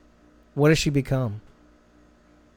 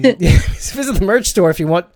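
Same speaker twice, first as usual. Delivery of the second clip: laughing; 5 semitones higher, 7 dB louder than usual. Real, not acted. Laughing plug for merch.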